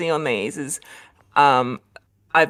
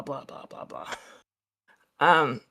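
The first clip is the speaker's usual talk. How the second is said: ordinary talk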